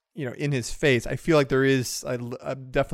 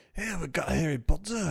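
mock Scottish accent, pitched up; put-on high Scottish burr; 4 semitones higher, 3 dB quieter than usual. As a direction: imitate a Scotsman in a higher voice. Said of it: "it was a Scottish accent".

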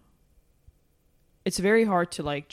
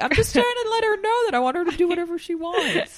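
much higher mock protest; high pleading guy; 11 semitones higher than usual. Friend voices the misunderstood texter, high mock pleading. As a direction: plead his case in a high put-on voice.

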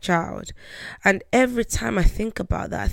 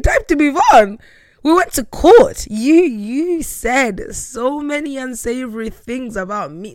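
much higher and louder, indignant; outraged retort; 7 semitones higher, 8 dB louder than usual. Retold or imitated. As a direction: fire back at him in disbelief.

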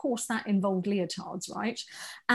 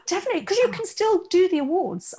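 excited gasp; 9 semitones higher, faster than usual. Real, not acted. Gasping at the idea.

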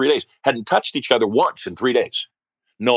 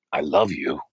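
low, slower, heartfelt declaration; a little passion; 7 semitones lower, 3 dB quieter, slower than usual. Retold or imitated. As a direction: imitate a man trying some passion. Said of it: "say it with a little bit of passion".

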